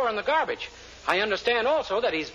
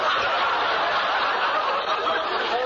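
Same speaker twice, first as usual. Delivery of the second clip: chuckle. Acted. Laughs at his own joke.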